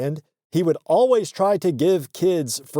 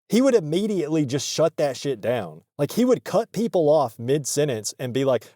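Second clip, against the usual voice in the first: ordinary delivery, nothing marked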